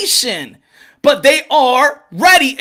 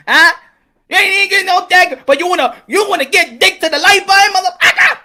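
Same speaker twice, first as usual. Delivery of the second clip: high, louder comic indignation; mock outrage, voice pitched way up; outraged girlfriend; 5 semitones higher, 4 dB louder, faster than usual. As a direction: rant in disbelief, rising to a squeal at the end. Acted girlfriend's outburst over button.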